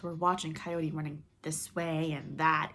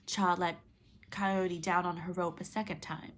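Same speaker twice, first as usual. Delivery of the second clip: usual speaking voice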